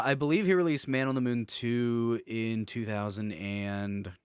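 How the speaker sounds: usual speaking voice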